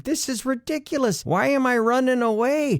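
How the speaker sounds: a flustered mock panic as you flee; panicked inner voice. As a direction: scold yourself in a rising panic.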